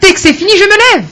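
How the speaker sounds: triumphant lift. Real, not acted. Speaker telling the youth to rise again, triumphant. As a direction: sound like rising from the ground. Guest preaching getting back up.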